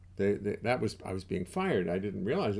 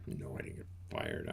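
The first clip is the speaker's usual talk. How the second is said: much lower, softer gruff denial; low mock reply; 9 dB quieter than usual. Low voice answering the squeaky one. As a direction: growl back the denial in a low voice.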